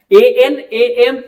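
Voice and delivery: "एएन, एएम" drawn out slowly through the nose; nasal an and am. Shown as a demonstration. Teacher drilling nasal vowels slowly and loudly. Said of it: "ये सारे नोजल sound होते हैं".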